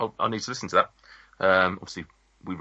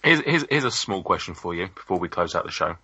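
ordinary talk

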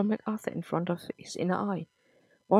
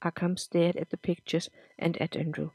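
ordinary delivery

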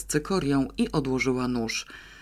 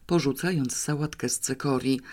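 usual speaking voice

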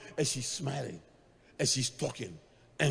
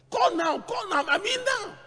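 urgent shout; 13 semitones higher than usual. Retold or imitated. Wife insisting in panic.

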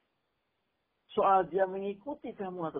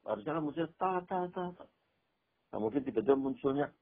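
ordinary talk

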